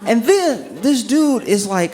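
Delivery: ordinary delivery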